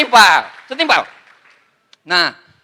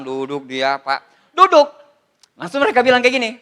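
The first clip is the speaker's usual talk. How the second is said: ordinary talk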